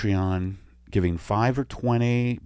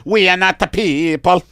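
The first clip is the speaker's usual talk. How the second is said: put-on Italian alien accent; 7 semitones higher, 7 dB louder than usual. Italian alien telling the doctor his first name, Italian accent. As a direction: introduce yourself grandly in Italian. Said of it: "you need to get a better Italian accent".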